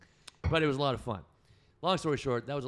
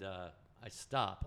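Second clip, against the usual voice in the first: normal talking voice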